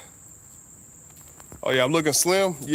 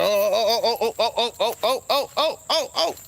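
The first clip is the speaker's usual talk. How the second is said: rising, much higher yelps through laughter; laughing yelps; 10 semitones higher than usual. Real, not acted. Driver yelps with laughter as the bug flies at him.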